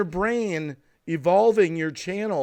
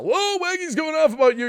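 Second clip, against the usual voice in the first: much higher and louder, mock-excited; mock gossip message; 12 semitones higher, 4 dB louder than usual. Streamer imagining a tip-off to his critic, mock gossip voice. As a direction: do the friend who tips someone off. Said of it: "They'll probably get a message right now".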